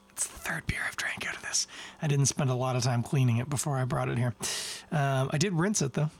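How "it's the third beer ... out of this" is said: hushed, unvoiced aside about the glass; whispered aside. Real, not acted. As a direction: whisper it, a sheepish confession. Confessing dirty glass aside.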